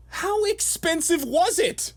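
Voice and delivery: slow, high, theatrical voice; high dramatic question. Pretend. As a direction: ask it like a game show host. Mock dramatic question after throat clear.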